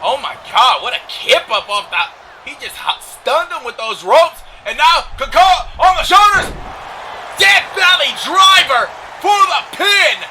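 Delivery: climbing to a high, loud shout; excited play-by-play. Real, not acted. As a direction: build to the finishing move, stretching the last call. Live play-by-play of wrestling.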